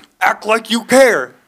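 high, slow, exaggerated imitation; mock demand. Worker mocking the customer's demand, high slow imitation.

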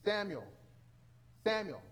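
a voice calling in the night; voice calling Samuel. Acted. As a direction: call out the boy's name, spaced apart. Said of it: "hears the, the voice".

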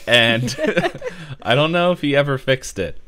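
high, loud, laughing through words; giggling while talking. Real, not acted.

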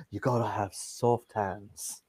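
put-on low, soft Liverpool accent. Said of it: "with that Liverpool accent".

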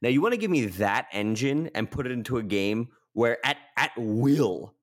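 ordinary talk

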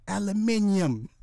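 high, slow British "Aluminium"; mock British accent. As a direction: spell out "Aluminium" slowly as a pretend Brit. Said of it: "imagine me telling the wife".

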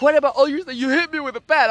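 high, re-enacted protest; indignant accusation. Acted quarrel, accusation.

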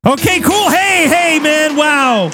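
high-pitched applause-raising announcer voice; excited high sign-off; show host hype. Real, not acted. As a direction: burst out hyping up the crowd.

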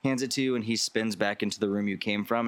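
usual speaking voice

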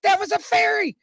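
high-pitched amazed insistence; excited exclaim. Retold or imitated. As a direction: blurt it out stunned. Retelling seeing a fairy.

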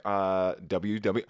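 usual speaking voice

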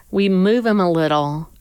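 normal talking voice

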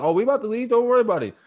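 high, loud acted comeback; mock heated retort. Mover telling the customer they're leaving, loud mock protest. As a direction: throw it back, high-pitched and insistent.

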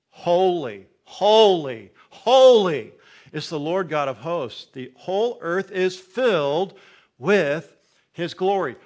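loud, slow, ringing "holy"; proclaiming angels' cry. Acted. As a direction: call it out like seraphim. Angels' cry in scripture reading.

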